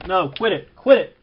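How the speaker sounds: sharp, rising shout of quit it; scolding the dog. Real, not acted. Owner yelling at the barking puppy mid-recording, sharp shout. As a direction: snap at the puppy to stop.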